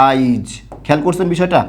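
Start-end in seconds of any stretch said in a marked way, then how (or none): 0.1-0.5 আইজ, আজ said with an extra ই; dialect pronunciation. Shown as a demonstration. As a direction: say আইজ instead of আজ. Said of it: "অনেকে এরকম উচ্চারণ করি". (আঞ্চলিক উচ্চারণে আইজ)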